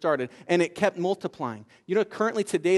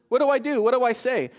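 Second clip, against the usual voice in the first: higher-pitched, flustered questions; mock panic; 6 semitones higher, 3 dB louder than usual. Overwhelmed woman faces waiting families, acted panic. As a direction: imitate someone at a loss, pleading.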